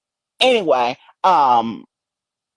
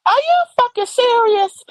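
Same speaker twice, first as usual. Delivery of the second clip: high, disbelieving outburst; can't believe it, pitch it way up; high-pitched disbelief; incredulous. Real, not acted. Ranting about Baby Q.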